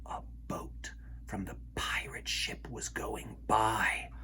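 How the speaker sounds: whispered, hiding from pirates; hushed whisper. A narrator's reading. Narrator hides the children from passing pirates, whispering. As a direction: breathe it so no one hears.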